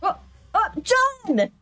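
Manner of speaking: high, thrilled shout of relief; excited shout. Real, not acted. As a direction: cheer the return. Relieved joy as character returns.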